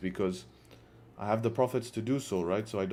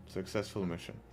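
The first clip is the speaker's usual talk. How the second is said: much softer, under the breath; quiet aside; 4 semitones lower, 10 dB quieter than usual. Shown as a demonstration. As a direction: murmur it to yourself, smug. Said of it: "repeats it as an aside".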